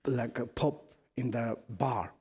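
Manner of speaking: usual speaking voice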